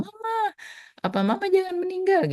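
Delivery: imitating her young son begging mama not to die; child crying. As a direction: plead like a crying little girl. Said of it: "dia tiba-tiba nangis, terus bilang".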